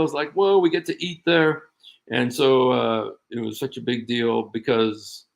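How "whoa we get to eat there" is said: mildly wowed, pitch lifted and louder; retold awe, higher and a bit louder; excited. Recalling a big deal meal in Egypt, excited.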